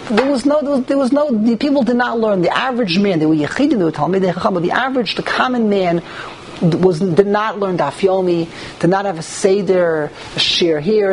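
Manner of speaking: ordinary talk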